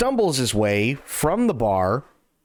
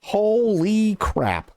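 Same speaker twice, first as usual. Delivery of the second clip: slow amazed exclamation; 6 semitones higher, much slower than usual. Real, not acted. Amazed by ten gifted subs.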